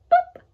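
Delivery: high, loud little pops; playful boops. Acted. Showing children the blackbirds landing, playful boops. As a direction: pop the birds onto the wire.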